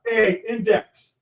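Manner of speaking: proud retelling of a son's yell; imitated yell. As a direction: call it out like a kid mid-battle, stretched long. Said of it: "he yells out".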